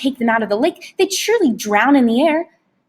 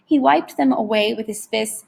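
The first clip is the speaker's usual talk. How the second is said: ordinary delivery, nothing marked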